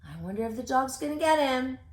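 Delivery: usual speaking voice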